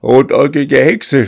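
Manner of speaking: high, loud furious scream of the insult. A narrator's reading. Master pinning the old woman to the wall, furious scream. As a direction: hurl the words at her in rage. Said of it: "schrie er".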